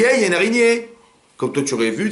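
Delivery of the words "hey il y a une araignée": high-pitched exclamation of alarm; mock cry out. Wife alerting husband to a spider, imitated high cry. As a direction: call out the spider in a raised, high voice. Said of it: "alors elle a crié".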